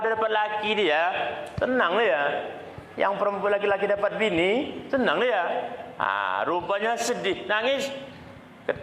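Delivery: ordinary delivery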